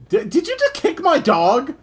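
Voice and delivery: high, loud disbelief; outraged surprise at the screen; shocked outburst. Real, not acted. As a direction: blurt it out in real shock. Dog kicked during cutscene.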